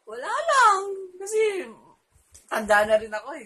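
high, loud, giggly reply to mom; laughing it off, squealing high and loud; giddy high retort. Retold or imitated. Daughter answering mom's why-marry question, giddy and high-pitched. Telling parents she's marrying.

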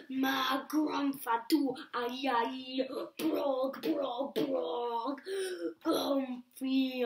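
slow, loud goofy gibberish; silly nonsense sounds. Acted. Reader making silly sounds for the child. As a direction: ham up the nonsense words. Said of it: "make silly sounds like".

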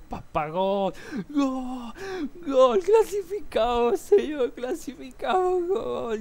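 high, loud, near-crying goal shout; goal celebration yell. Retold or imitated. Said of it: "salí gritando por toda la casa".

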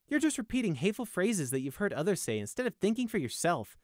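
reproachful, measured rebuke; earnest scolding. A narrator's reading. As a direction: lecture him seriously. Guard scolds the prisoner.

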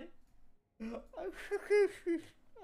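high laugh that breaks into a sob; a real laugh-cry that spills out; laughing while crying. Real, not acted. Player overwhelmed by a game character's farewell, laugh-crying. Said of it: "I'm crying right now".

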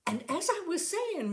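high, bright cover-up voice; suddenly cheerful for the boss; brightly. A narrator's reading. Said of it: "Missus Brady said at once brightly".

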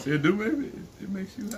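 high, incredulous question; can't believe what she heard; surprised. Real, not acted. Shocked at wine claim.

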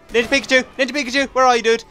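high, urgent call to a teammate. Pretend. Player looks for his ninja teammate, calling out. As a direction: call out for your missing buddy.

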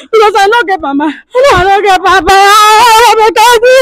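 real tearful wailing. Real, not acted. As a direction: stretch the words out into a wail. Abandoned woman laments having no parents.

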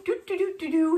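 high, sing-song imitated trotting; trotting sound effect. Dog trots right up to her, bouncy imitation. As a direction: voice a happy puppy's little trot.

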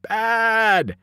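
high, drawn-out narrated scream. A narrator's reading. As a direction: cry it out high, not at full volume. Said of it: "who screamed".

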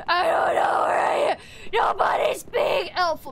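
raspy exasperated shouting; harsh, fed-up outburst; frustrated yell. Real, not acted. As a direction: shout it as if at your wits' end. Frustrated outburst, lost in game.

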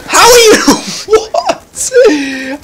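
very high squeal breaking into laughter; incredulous outcry. Real, not acted. Gamer baffled that an enemy survives, incredulous outcry. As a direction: shriek in disbelief, then laugh.

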